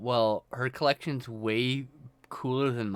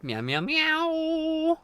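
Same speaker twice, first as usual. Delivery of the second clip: high playful meowing; nervous cat voice; 11 semitones higher, 7 dB louder, slower than usual. Pretend. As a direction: meow like a needy kitten. Meowing back and forth.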